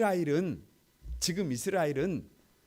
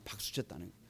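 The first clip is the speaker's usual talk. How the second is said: very soft and low; preacher quietly repeating the answer; hushed; 8 semitones lower, 14 dB quieter, faster than usual. Real, not acted. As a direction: drop the voice to a hush. Preacher whispers the accusation again.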